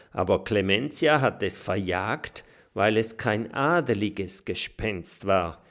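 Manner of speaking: plain reading voice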